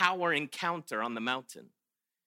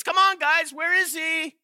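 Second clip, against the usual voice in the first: higher teasing call to the crowd; mocking taunt; 7 semitones higher than usual. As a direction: goad them, playfully mocking. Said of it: "He's taunting them".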